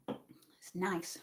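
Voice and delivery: whisper. Real, not acted. Aside about her drink.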